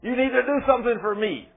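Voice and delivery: high, insistent imitated plea; crying out. Wounded servant pleading with Allah for repayment, crying out. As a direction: retell a desperate cry to a god, pitched high. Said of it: "I'm crying out to Allah".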